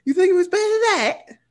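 high, exaggerated London accent; aggressive London accent. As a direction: protest in a hammed-up London accent, pitch climbing. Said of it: "aggressive London accent".

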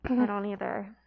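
ordinary delivery

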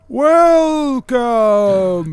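slow, drawn-out welcome. Acted. Turtle dockhand greeting guests, slow and drawn out. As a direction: stretch the word out slowly. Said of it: "They talk slow".

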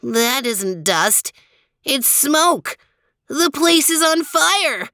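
rising alarm. Acted. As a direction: realise the danger, voice climbing. Lestrade discovers fire.

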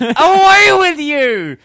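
loud, high, drawn-out bellow; slow, theatrical exorcist cry; mock banishing shout. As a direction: cast them out with a hammy yell.

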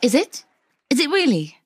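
ordinary talk, nothing marked

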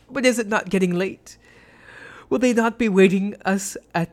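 frightened mock-jolly high voice; Fortunato laughing. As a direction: laugh off the terror, begging to leave. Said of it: "a sad voice".

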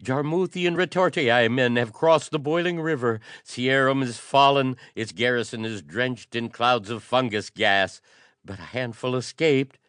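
high, loud, breathless wail of doom; panting cry of woe. A narrator's reading. Exhausted courier crying woe to the council. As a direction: gasp it out like a spent runner crying disaster.

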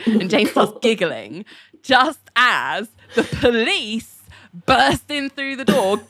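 ordinary talk, nothing marked

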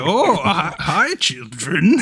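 slow, lightly voiced old janitor; old man voice. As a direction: drawl it out like a kindly old man. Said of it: "the old man voice that I always do".

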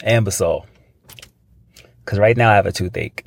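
usual speaking voice

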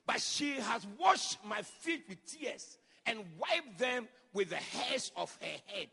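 slow, loud preaching with punched words; full-force preaching. Acted. Preacher retells Jesus' words to the church, loud and slow. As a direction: preach it out to the congregation.

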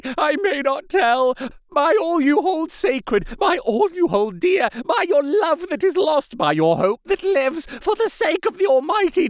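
high, frantic begging through tears; desperate tearful pleading. A narrator's reading. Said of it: "poured forth a torrent of entreaty".

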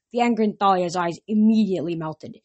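plain reading voice

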